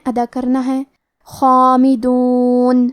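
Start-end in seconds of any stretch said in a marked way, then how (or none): 1.3-2.9 خامدون slow, خا said heavy and full with a long مد; heavy kha. Shown as a demonstration. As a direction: give خا in خامدون a heavy sound. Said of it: "بھاری آواز میں پڑھیں گے". (خا کو بھاری آواز میں)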